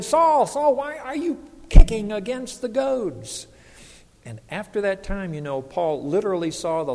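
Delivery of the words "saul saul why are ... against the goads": booming call, much higher and louder; divine call. Retold or imitated. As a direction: call out like God from heaven. God calls Paul on the road.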